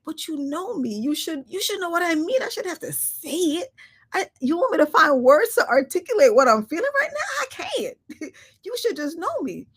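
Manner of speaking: high, pleading, half-laughing; imitating her own frustrated plea, high and laughing; mock pleading. Acted plea to people.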